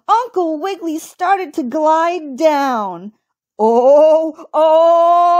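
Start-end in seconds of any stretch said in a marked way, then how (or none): 3.6-5.4 loud, high, long-held "oh" wail; crying out. A narrator's reading. Old rabbit gliding downhill, loud drawn-out cry. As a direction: wail as if sliding helplessly downhill. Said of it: "he cried".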